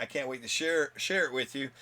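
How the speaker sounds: ordinary talk, nothing marked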